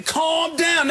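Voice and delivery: his own commercial line, shouted high; shouted calm down. Retold or imitated. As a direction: bark it like settling someone down. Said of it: "That's my voice".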